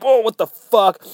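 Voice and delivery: high, loud comic astonishment; mock startled shout. Laughing Man reacts to a punch, high mock shock. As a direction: yelp it in exaggerated shock.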